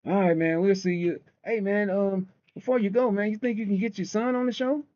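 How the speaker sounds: high, mocking host voice; mimicking the host. Mocking the show host asking about his son. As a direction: ham up the host signing off. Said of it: "End of the show come".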